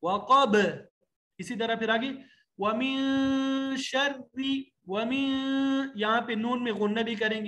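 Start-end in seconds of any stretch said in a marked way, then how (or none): 0.0-0.6 وقب ending in a loud bouncing ب; qalqala on ba. Shown as a demonstration. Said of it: "با میں آواز ہلتی ہوئی". 2.6-5.9 slow, drawn-out tajweed chant; teacher reciting verse slowly; Quranic recitation. Shown as a demonstration. Recitation demo.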